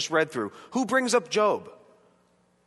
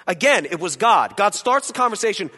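normal talking voice

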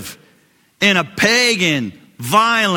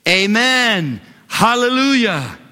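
high ringing amen hallelujah; rising cry of praise; shouted praise; 6 semitones higher, slower than usual. Shown as a demonstration. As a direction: call it out for the church to repeat. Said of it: "say it".

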